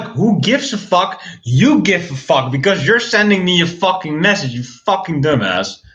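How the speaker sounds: high-pitched mock-irate comeback; exasperated retort. Streamer retelling a comeback to Alex's messages, exasperated.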